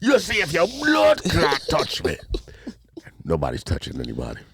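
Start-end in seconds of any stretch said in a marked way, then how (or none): 0.0-2.2 drawn-out, higher Jamaican shouting; aggressive Jamaican accent. Retold or imitated. Imitating Jamaicans going back and forth, worked-up Jamaican accent. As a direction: imitate a Jamaican threatening without ever swinging. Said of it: "I've never seen a Jamaican physically fight. You know what I see?".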